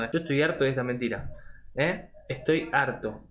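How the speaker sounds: ordinary delivery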